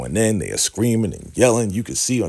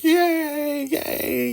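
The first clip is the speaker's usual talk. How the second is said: squealed falsetto crowd cheer; mock cheering. Everybody reacting to the prophecy, mock cheering. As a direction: imitate thrilled followers in a very high voice. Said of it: "they're all cheering and everything".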